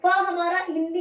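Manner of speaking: ordinary talk, nothing marked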